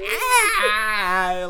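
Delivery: short high yelp of panic; mock scream. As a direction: let out a quick retold scream of fright. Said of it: "scream at the same time".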